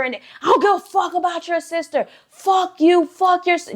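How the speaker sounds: high-pitched retold angry outburst; going off. Man dismissing girlfriend's sister, going off angrily. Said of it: "just going off".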